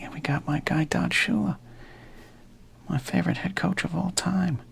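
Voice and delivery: rising, excited lift over a favorite coach; a burst of fond excitement breaking through the whisper; emotional excitement. Real, not acted. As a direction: let the voice jump up with fond excitement. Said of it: "I'm almost getting emotional".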